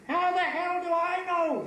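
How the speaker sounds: high-pitched exasperated cry; screamed. Acted. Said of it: "screamed Feigelman".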